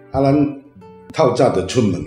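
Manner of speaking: normal talking voice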